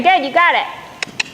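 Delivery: usual speaking voice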